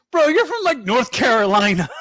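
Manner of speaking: high-pitched incredulous retort; mock disbelief. Fan answering the wrestler's gripe, incredulous high retort. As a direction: call him out, pitch jumping up.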